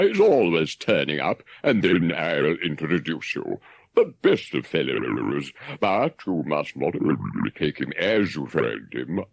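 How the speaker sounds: a hint of a breezy water rat putting him off; the rat's voice. A narrator's reading. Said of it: "the rat would say".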